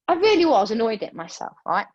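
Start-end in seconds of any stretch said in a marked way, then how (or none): none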